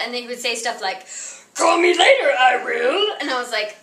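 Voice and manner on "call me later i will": higher, louder Yoda croak settling down at the end; mock Yoda sign-off. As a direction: pop up into a Yoda voice and drop back. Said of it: "he would not stop talking like Yoda".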